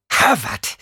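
furious snap of one name; snapped name. A narrator's reading. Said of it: "fauchte Jessica".

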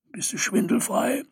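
high, far-off croaking gnome; krächzende Gnomstimme. Acted. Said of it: "fragte er mit krächzender Stimme". (krächzende Stimme eines alten Gnoms)

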